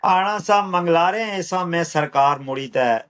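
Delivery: rhythmic, measured verse with a returning refrain; poetry recital. Acted. Poet reciting a poem on migration, measured rhythmic recital. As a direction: recite it unhurried, falling on each refrain.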